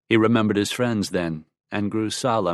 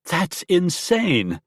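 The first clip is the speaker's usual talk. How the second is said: much higher, slow shocked outcry; cried out; 10 semitones higher, much slower than usual. Acted. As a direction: cry it out in disbelief. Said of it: "Then she cried".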